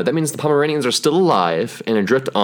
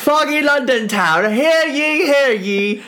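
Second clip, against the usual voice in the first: high, slow proclaiming crier; town crier; slower than usual. Pretend. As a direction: hear ye, bellow the royal news. Announcing the queen's tiny dog.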